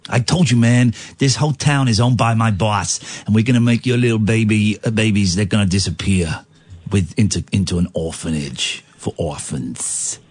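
low, menacing mobster put-on, sinking at the end; gangster. Henchman threatening to make babies disappear, mock menace. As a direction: deliver a mock mob threat, slowing to a sinister finish.

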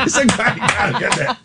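high, giggly, talking through laughter; laughing. Real, not acted. Host tickled by his own old joke, high and laughing. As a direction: squeeze the words out between giggles.

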